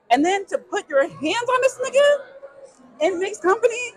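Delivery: indignant. Real, not acted. Telling her friend about the slap, high and indignant. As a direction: rise in outrage. Outraged retelling.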